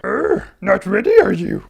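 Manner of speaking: Yoda voice. Retold or imitated. Yoda voiced in movie retelling.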